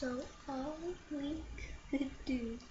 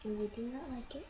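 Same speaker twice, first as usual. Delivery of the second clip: rushed reading; much faster than usual. Real, not acted. Child reads fast.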